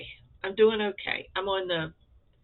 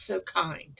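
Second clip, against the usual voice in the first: ordinary talk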